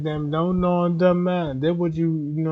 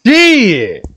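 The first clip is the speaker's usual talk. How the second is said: loud exclamation; 5 semitones higher, 12 dB louder than usual. Real, not acted. Loud exclamation after hype.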